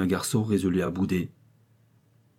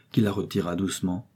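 plain reading voice

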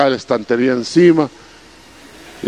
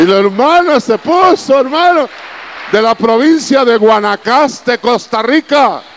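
much higher, louder, slowed for wonder; amazed telling; 9 semitones higher, 3 dB louder, slower than usual. Retold or imitated. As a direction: marvel at the sister's reaction. Sister's amazement retold. (asombro, mucho más agudo y fuerte, lento)

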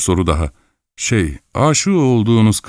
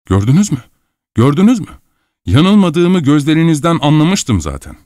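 high, insistent exclamations building in pitch; excited triumph; 7 semitones higher, 3 dB louder than usual. Acted. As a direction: press the point triumphantly. Man triumphantly presses his point.

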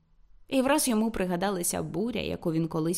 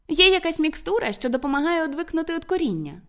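high, raised call through a door; 9 semitones higher, 3 dB louder than usual. A narrator's reading. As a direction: call it after him, pitched up. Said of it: "гукнула вона йому вже крізь двері".

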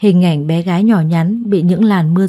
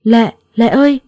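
higher, louder panicked call of her name; 4 semitones higher, 4 dB louder than usual. A narrator's reading. Phong panicking as he finds the injured Lệ. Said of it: "giọng hốt hoảng của cậu ấy".

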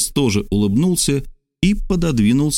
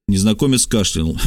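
plain reading voice

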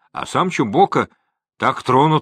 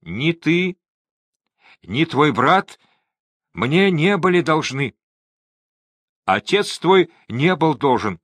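higher-pitched, mildly anxious confession; anxious admission; 6 semitones higher than usual. A narrator's reading. Cornered trader admitting to Chumboka, mildly anxious. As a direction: give in with a raised voice.

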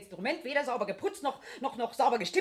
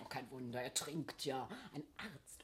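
mock whisper; 11 semitones lower, 16 dB quieter, slower than usual. Gossiping about the cellist drinking.

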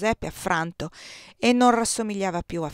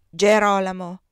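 sharp interruption; 11 dB louder than usual. Acted.

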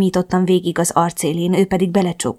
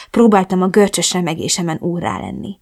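usual speaking voice

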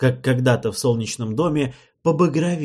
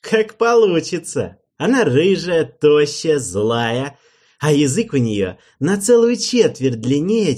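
gloating king; 6 semitones higher, 7 dB louder than usual. Acted. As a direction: relish every insult.

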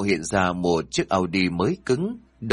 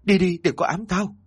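much higher, desperate get away; terrified cry; 9 semitones higher than usual. A narrator's reading. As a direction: beg the ghosts to leave you alone. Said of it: "Sợ quá". (la lên hoảng loạn, cao vọt)

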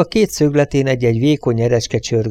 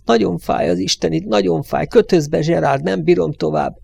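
pained plea; 6 semitones higher than usual. Acted. Wounded man begging to be bandaged.

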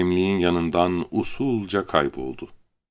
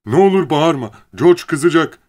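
high, loud, panicky begging; pleading; 9 semitones higher, 7 dB louder than usual. A narrator's reading. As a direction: plead urgently in a raised voice. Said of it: "diye yalvardı". (panikle yalvararak)

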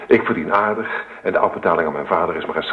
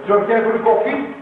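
called out; 3 dB louder than usual. Acted. Husband calls out to wife.

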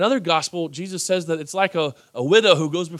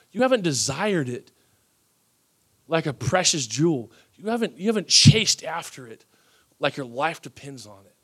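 ordinary talk

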